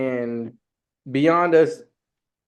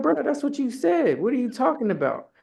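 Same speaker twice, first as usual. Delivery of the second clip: incredulous protest; 12 semitones higher than usual. Retold or imitated. Arguing with Jerry over the story, high incredulous protest. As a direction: plead it in mock disbelief.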